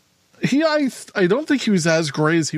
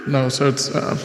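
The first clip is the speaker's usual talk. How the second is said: ordinary delivery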